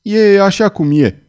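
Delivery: high, loud, drawn-out retort; sharp, irritated outburst; snapping. A narrator's reading. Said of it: "se răsti Claude".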